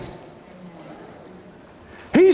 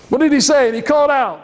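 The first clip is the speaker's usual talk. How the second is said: ordinary talk, nothing marked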